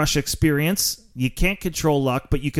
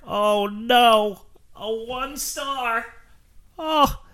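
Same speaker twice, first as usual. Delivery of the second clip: mock dismay; 8 semitones higher, much slower than usual. Podcaster mocks listeners' one star rating, with fake dismay. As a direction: pretend to be crushed by a bad rating, hammed up.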